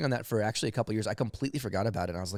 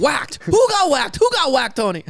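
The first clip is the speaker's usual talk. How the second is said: much higher, louder frantic mafia yell; frantic shout; 9 dB louder than usual. Acted. Boss panics over who got whacked, much higher frantic shout.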